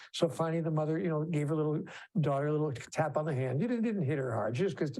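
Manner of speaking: normal talking voice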